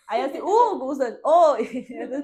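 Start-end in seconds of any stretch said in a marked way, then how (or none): 0.4-0.8 loud call across the house; shouting the name Hugo. Retold or imitated. Calling out to both Hugos. 1.2-1.8 a loud "oi" called back; imitating both answering loudly. Both Hugos answer at once.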